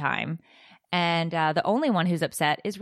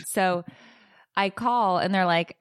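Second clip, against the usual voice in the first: ordinary talk, nothing marked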